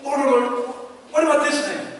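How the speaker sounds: a hint of a desperate intercessor; Abraham pleading. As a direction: plead as if lives depend on it. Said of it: "He's saying".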